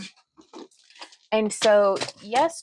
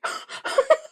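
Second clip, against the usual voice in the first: high-pitched giggle. Real, not acted. High laugh.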